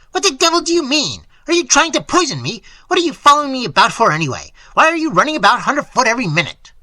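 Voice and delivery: loud, high, rapid-fire accusations; irate old painter barking questions; angry yelling. A narrator's reading. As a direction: fire questions at him furiously. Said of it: "but he barked at me".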